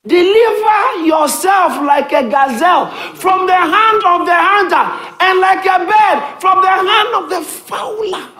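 high, loud fiery scripture shout; shouting preacher. Acted. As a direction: proclaim it at the top of your voice.

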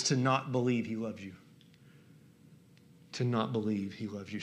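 low, soft, sorrowful weight; quiet, solemn emphasis; grave, hushed. Real, not acted. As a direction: drop low and let it sink in. Grave repeat of quoted line.

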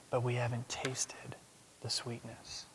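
hushed, breathy close; whisper. Real, not acted. Preacher whispering about tasting sweetness. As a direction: let it fade to a whisper.

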